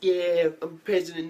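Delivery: loud, high, drawn-out boast; swaggering arrival voice. Retold or imitated. Dude rolling in in his weird vehicle, loud mock boast.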